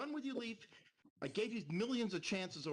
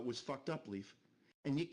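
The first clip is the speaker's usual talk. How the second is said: normal talking voice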